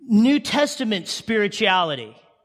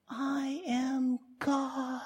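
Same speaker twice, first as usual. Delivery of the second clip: slow, much softer, small proclamation; tiny mock declaration; 12 dB quieter, much slower than usual. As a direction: say it as if heard from far above. Imagined MacLaine on the beach.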